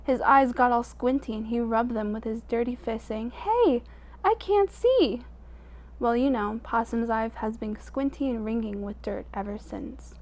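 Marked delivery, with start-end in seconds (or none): 3.3-5.2 high, slow squinting little voice, dazed by the light; blinded Possum. A narrator's reading. Possum blinded by the sun, high and slow. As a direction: pitch it way up and drag it out.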